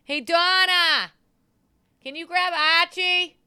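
slow, high, loud holler; calling out. Real, not acted. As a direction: holler for her help across the house. Calling out to Donna for help.

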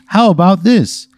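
high, slow, loud pitchman reveal; hammed-up commercial Mountie, drawn out; Canadian Mountie. Mountie presenting poutine to the lady, hammed-up. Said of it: "And then he goes".

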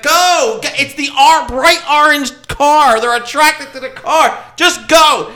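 imitated exasperated keeper yelling; urgent zookeeper. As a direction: shout them away impatiently. Zookeeper urgently ordering them off.

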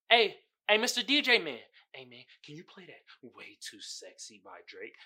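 an eager clubgoer begging the DJ; club guy asking the DJ. Retold or imitated. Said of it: "that's how it sounds in my head".

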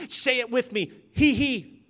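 imitating an intense old preacher; mock-fervent call to repeat after me; fervent prayer leader. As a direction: punch out each syllable pair, loud, with long gaps. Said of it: "with great intensity and great fervor".

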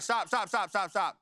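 rapid-fire repeated order, lightly urgent; imitating a rattled driving teacher; urgent mock command. Father halts son's jerking truck, urgent.

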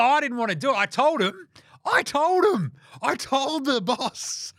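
high, increasingly insistent excuse-making; mock protesting. Host mimics coach insisting he warned the boss, high mock protest. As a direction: imitate a coach pleading innocence, pitch climbing.

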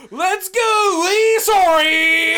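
high, drawn-out re-enacted chant; drunken fan holler. Drunk fan chanting at the game, stretched-out shout. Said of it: "I'm, like, drunk off, like, Bud Light".

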